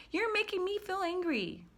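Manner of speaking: very high, whiny mock complaint; mock accuser. Imitating someone blaming me for their emotions, mock complaint. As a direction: imitate a blamer in a squeaky high voice.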